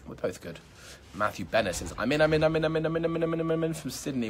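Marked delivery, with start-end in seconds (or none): 2.0-4.3 high, loud, rapid-fire monotone chant; excited repeated comment. Retold or imitated. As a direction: rattle it off high, like an over-eager fan.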